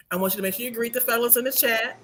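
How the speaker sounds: ordinary talk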